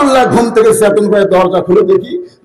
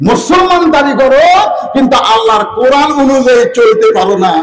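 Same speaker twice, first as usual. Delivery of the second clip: high, emphatic sermon rebuke; full-force preaching; 6 semitones higher than usual. Real, not acted. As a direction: admonish the congregation in a raised voice. (উঁচু গলায় জোরালো ওয়াজ)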